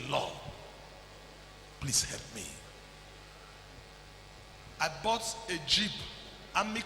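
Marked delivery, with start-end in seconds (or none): 0.1-2.4 pleading prayer. Retold or imitated. Retold prayer.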